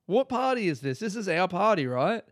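high, baffled and indignant; incredulous. Retold or imitated. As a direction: act confused, what party?. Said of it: "And I'm like".

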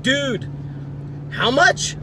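high, loud disbelieving "how much"; mock shock. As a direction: gasp out the price in fake outrage. Retold shocked reply to fees.

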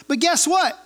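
ordinary delivery

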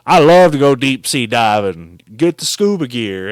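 loud, low imitation of a lewd smooth-talker; hammed-up low sweet-talker; Silk. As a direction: boom it out low, mock come-on. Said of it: "And he was like".

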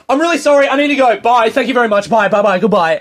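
stressed escape. Retold or imitated. Comedian bailing out of the meeting room, high frantic goodbyes. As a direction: blurt it out desperate to get out the door. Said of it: "And I'm stressed and I start flying".

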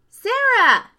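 high, loud, delighted shout; excited call. A narrator's reading. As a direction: call out the name like an eager child. Small boy calls out in greeting.

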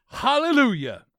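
high, loud hallelujah; mock-pious cry. Reader mocking a scam email's religious sign-off, high exultant cry. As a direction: send it up like a revival shout.

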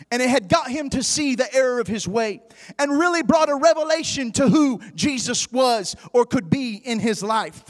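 ordinary delivery

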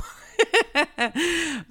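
bright laugh. Real, not acted. Mom laughing about her homemade tea, a bright laugh. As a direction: laugh at yourself.

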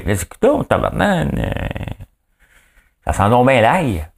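high-pitched grumbling recoil; mock disgusted complaint. Partner smells garlic on the milk, mock disgusted complaint.

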